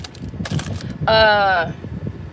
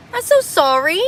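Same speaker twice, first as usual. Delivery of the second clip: high sugary fake apology; mock apology; 6 semitones higher than usual. Real, not acted. Mocking viewers who say she's too much, sugary fake sorry. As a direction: sweeten it sarcastically.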